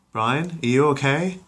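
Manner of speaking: high, slow worried call; a worried mom calling through the door; Lois calling to Brian. Acted. Lois checking on her itchy dog, high and worried.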